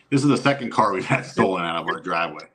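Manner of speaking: ordinary talk, nothing marked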